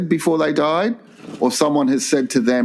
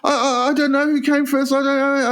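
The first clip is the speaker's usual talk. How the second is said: put-on high, panicky stammering counter girl; flustered girl; 11 semitones higher than usual. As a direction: stammer it out like a flustered young waitress. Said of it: "She's going".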